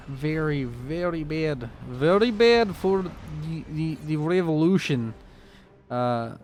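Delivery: put-on, half-Russian accent; muddled Russian accent. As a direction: badly fake a Russian accent. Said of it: "I don't know what accent this is".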